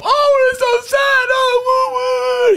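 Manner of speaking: high, loud, hammed-up wailing; mock wailing women. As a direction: overdo the weeping mourners for laughs. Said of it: "all the women go up".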